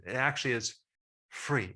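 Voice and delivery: ordinary talk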